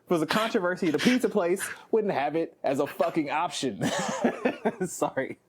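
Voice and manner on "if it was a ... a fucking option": normal talking voice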